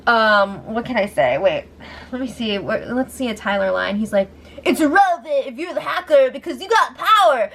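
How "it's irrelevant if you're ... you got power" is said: lightly voiced surfer dude, raised and higher; a hint of a laid-back surfer getting heated. Tyler snapping back at Bailey, lightly voiced surfer. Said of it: "a raised surfer voice".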